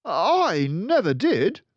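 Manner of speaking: high, loud, slow protest; frightened exclaim. Acted. Boy denying he stole the cake, loud protest. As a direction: cry out the denial. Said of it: "the boy exclaimed".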